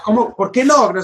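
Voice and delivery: high-pitched, baffled questioning; retold astonished objection; incredulous protest. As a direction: act out disbelief at being refused. Acts out disbelief at driver's refusal.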